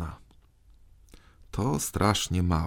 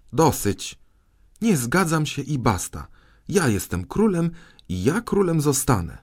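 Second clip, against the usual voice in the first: high, indignant royal refusal; defiant insistence; 7 semitones higher than usual. A narrator's reading. Boy king asserting himself before his ministers, defiant. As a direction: put your foot down, pitch raised.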